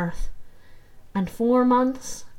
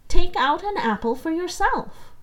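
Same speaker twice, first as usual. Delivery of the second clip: coaxing stepmother; 8 semitones higher, 4 dB louder than usual. Acted. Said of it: "as if she had to persuade him".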